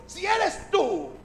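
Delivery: high, slow, loud call. Acted. Pedro calls out to Jesus from the boat, high drawn-out shout. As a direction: stretch it out across the water.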